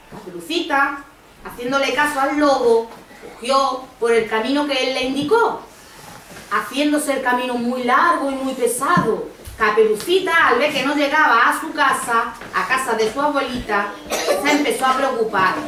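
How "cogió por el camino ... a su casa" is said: ordinary delivery, nothing marked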